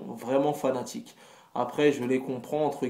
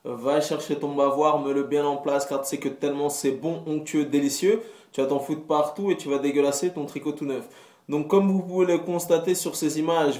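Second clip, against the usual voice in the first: ordinary talk, nothing marked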